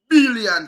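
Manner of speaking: BILLION loud, exploding from the B; punched B. Shown as a demonstration. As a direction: hit the B of BILLION hard and high. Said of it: "him not say".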